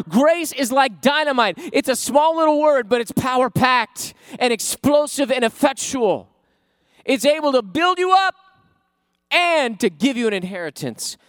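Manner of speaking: loud, high, shouting the sermon; full-force preaching. Acted. Pastor preaching grace to the church, shouting with fire. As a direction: build it up and let it explode.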